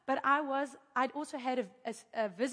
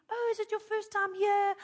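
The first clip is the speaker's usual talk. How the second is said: friendly greeting; 7 semitones higher than usual. Retold or imitated. Acts out friendly chauffeur greeting her.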